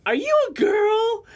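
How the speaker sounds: put-on high, slow little boy's voice, far above his own; little kid voice. Storyteller voicing small boy at hot springs, high child voice.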